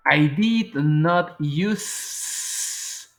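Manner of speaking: drawn out word by word; very slow demo. Shown as a demonstration. Teacher showing negation to students, very slow. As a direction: stretch every word of I did not use. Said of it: "En negación".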